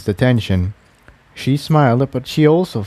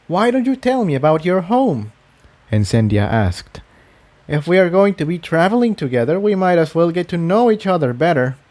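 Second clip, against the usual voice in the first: higher, curious mare voice; higher inviting question; friendly Incendia; 6 semitones higher than usual. Acted. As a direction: invite them to talk. Incendia asks about home.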